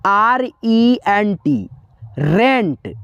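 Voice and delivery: letters drawn out slowly, then rent; slow letter-by-letter spelling. Shown as a demonstration. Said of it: "देखिए लिखा है".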